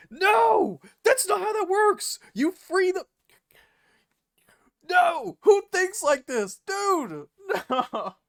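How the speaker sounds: ordinary delivery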